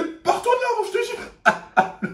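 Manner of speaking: hammed-up schoolmates telling him to get lost; mock brush-off. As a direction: wave him off in a silly voice.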